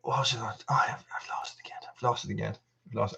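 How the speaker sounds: whispered to himself, barely voiced. Real, not acted. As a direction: breathe it out, annoyed at losing it. Lost the chat, muttering to self.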